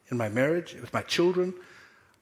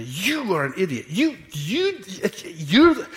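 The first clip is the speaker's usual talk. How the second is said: jabbing finger-pointing "you, you", pitch jumping high; mock accusing; 3 semitones higher, slower than usual. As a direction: play the blaming husband, half-acted. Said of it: "I can't go to her and say".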